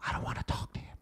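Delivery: unvoiced whisper imitating his mother refusing; whispering mom. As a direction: whisper it as if hiding from the phone. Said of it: "I hear her".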